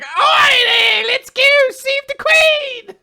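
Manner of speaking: high, loud hammed-up British accent; mock posh British shout. Imagining riders calling dragons, hammed-up British accent shouting. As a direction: yell it like a silly posh Brit. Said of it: "stupidly British dragon".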